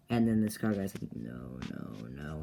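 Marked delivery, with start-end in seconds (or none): 1.1-2.4 deep, quiet refusal; low villain voice. Retold or imitated. Scar guy refuses Bloodman's threat, low quiet villain voice. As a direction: keep it low and hushed, creeping upward.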